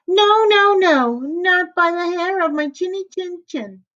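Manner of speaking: high pig voice shouting no; squeaky pig refusal. Acted. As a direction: refuse the wolf in a tiny piggy voice.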